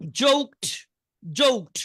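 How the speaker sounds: জোকড pronounced "jokt"; teacher models জোকড with a released t; final t sound. Shown as a demonstration. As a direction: end জোকড on t instead of d. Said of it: "টি উচ্চারণ হবে".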